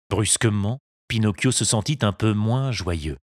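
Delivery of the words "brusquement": usual speaking voice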